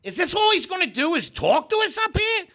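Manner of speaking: high, hollered mock complaint; hard-of-hearing old audience member. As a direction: talk way up as if nobody can hear you. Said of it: "they can't hear each other, so they're talking".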